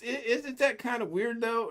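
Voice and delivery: high, puzzled mock voice; imitating Alex. Alex questions Ken in a high mock voice. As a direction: raise the voice way up, puzzled. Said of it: "He goes".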